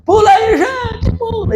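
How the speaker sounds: high excited call to jump; imitating a praise leader firing up the crowd; imitating a worship leader urging the crowd to jump; hyped worship leader. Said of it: "ministrar louvor animado".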